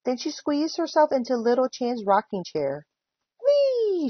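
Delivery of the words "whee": long, high-pitched whee; high drawn-out cheer; gleeful shout. A narrator's reading. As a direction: squeal it as if rocking wildly. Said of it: "she shouted".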